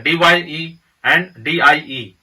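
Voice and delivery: डीवाईई and डीआईई spelled letter by letter, slowly. Shown as a demonstration. Teacher contrasts two spellings for students, slowly. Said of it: "तो spelling अलग-अलग है".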